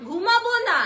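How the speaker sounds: put-on high, loud, childish whine; loudly aping her child's won't-sleep whine; mock child. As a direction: echo the child's refusal like a kid. Echoing the child's words.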